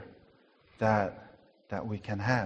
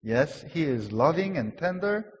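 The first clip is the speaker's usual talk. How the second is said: normal talking voice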